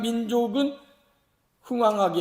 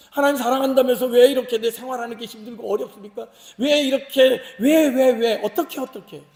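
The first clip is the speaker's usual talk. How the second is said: building, louder and higher acted complaint; protesting; 3 semitones higher, 6 dB louder than usual. Worshipper asking God why life is so hard, protesting. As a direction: pile up whys in mounting exasperation. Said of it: "이런 항변을 하고 싶을 때가".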